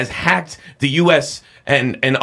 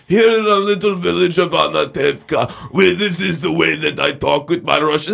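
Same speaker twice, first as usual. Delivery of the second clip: hammed-up movie Russian accent, pitched up; bad Russian accent; 4 semitones higher than usual. Host mocks film's Israeli lead, bad Russian accent. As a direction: do a fake Fiddler on the Roof Russian. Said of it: "That's not a Russian accent".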